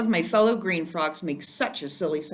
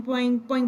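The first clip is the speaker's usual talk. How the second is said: boing call; 6 semitones higher than usual. Acted. Frog imitating friends' boing call.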